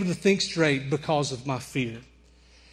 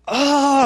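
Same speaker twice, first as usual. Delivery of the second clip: high, loud startled yelp; scream; 8 semitones higher, 6 dB louder than usual. Retold or imitated. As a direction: cry out in fright. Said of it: "I'm screaming again".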